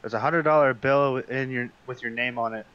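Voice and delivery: ordinary talk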